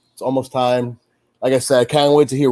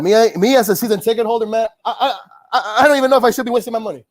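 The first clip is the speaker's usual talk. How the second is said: high-pitched, rapid exasperated venting; exasperated rant; 7 semitones higher, much faster than usual. Real, not acted. Season ticket holder ranting in dismay.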